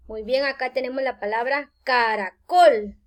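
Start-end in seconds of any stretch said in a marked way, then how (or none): 1.8-3.0 "caracol" with the final COL punched louder; stress on last syllable. Shown as a demonstration. Teacher introducing the example word to students, stress on last syllable. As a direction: say "caracol" landing hard on COL. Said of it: "la mayor fuerza al pronunciar caracol cae en la última".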